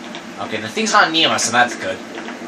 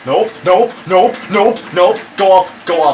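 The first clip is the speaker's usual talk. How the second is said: being chased; 2 semitones higher, 3 dB louder than usual. Real, not acted.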